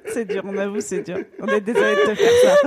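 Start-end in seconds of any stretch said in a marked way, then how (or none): 1.4-2.7 high, laughing through the words; cracking up while apologising. Real, not acted.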